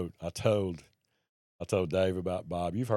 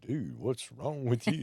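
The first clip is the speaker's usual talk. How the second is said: slow, higher, lightly voiced dog asking what's wrong; puzzled dog voice; 4 semitones higher than usual. German Shepherd staring at his sobbing owner, slow and puzzled. Voicing the dog's puzzled look.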